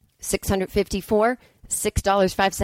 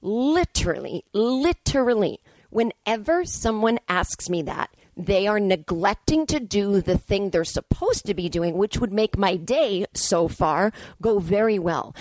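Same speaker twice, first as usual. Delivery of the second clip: slow emphatic; slower than usual. Real, not acted. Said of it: "slow emphatic rant".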